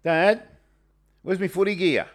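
high-pitched loud call for Dad; yelling across the room. Retold or imitated. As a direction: shout it up the house like a kid. Son calling out to dad.

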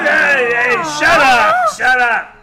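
loud hush. Real, not acted. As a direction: call the noisy table to order. Quieting the table for a toast.

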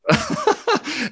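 a sudden high laugh of disbelief; high burst of laughter. Real, not acted. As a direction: laugh out in shock at the question. Laughing at shocking question.

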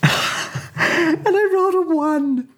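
high, slow words squeezed through laughter; real high-pitched laughing confession. Real, not acted. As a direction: laugh helplessly while admitting it. Laughs at own disastrous dice roll.

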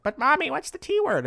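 put-on very high, loud kid voice; child's voice. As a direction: play a child asking mommy. Child questioning parent, acted.

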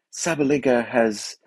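put-on slow, posh BBC tennis commentator in a British accent; British accent like a BBC Wimbledon commentator. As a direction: mimic a British radio announcer's measured tone. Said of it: "That was a good British accent".

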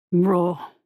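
low contemptuous grunt; deep scornful grunt from the shadows. A narrator's reading. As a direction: grunt it out from the dark like a beast. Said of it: "grunted a deep voice".

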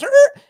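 short, high, loud screech noise; sudden sharp screech, far above the speaking voice; vocal screech to stop the action; imitated screech. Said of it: "all of a sudden it goes".

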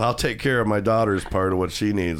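normal talking voice

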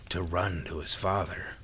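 plain reading voice

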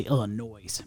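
Illinois with a "noise" ending; sounded final S in Illinois. Shown as a demonstration. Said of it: "I say Illinois".

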